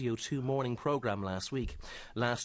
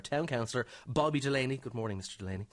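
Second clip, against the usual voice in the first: ordinary talk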